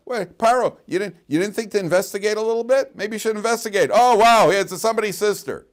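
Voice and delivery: high, loud scolding of Pharaoh; exasperated rebuke. Acted. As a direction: scold him loudly for not checking. Torah voice scolding Paro.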